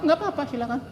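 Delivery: mock careless shrug. Mocking a man who never gets angry.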